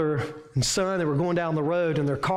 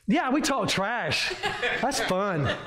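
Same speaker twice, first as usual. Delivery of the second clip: laughing; 4 semitones higher, slower than usual. Real, not acted. Laughing agreement.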